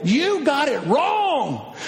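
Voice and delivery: normal talking voice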